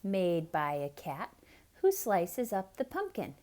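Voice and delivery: ordinary delivery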